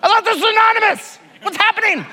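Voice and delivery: high, fast, loud mock-panicked shout; freaked out. Newcomer shocked his anonymity is gone, hammed-up panicked shout. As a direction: ham up the newcomer's alarm. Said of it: "Freaked out".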